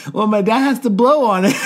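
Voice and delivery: real laughter breaking the words; laughing. Real, not acted. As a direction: try to say it but crack up. Quoting the kid through laughter.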